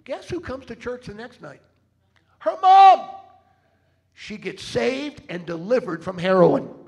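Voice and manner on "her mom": high, loud reveal; triumphant shout. Real, not acted. Preacher revealing who came to church, high triumphant shout. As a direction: belt the punchline.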